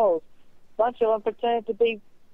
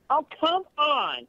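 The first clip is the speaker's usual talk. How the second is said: higher-pitched, drawn-out protest; exasperated yell; 6 semitones higher than usual. Real, not acted. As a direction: whine it out in exasperation, slowly. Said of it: "Don't yell at me".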